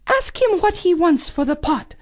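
high princess giving orders; a high bossy princess; cartoon princess. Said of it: "said the princess".

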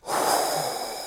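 imitated creaking chair sigh, breathy and soft. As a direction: sound like an old chair sighing in relief. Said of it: "sigh in relief".

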